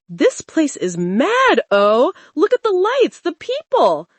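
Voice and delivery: overwhelmed by the lights and crowd; wide-eyed excitement. Acted. Schoolgirl amazed by her first nightclub, excited. Lillian's eyes widened in the club.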